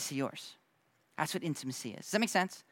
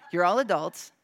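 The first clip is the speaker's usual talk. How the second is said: said through a laugh, a bit louder; 2 semitones higher, 5 dB louder than usual. Shown as a demonstration. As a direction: repeat it chuckling at your own joke. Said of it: "except you".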